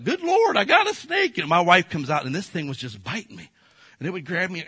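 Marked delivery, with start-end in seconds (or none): 0.0-1.4 much higher panicked call; alarmed cry. Retold or imitated. Preacher calling his wife about the snake, high alarmed cry. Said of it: "I was like".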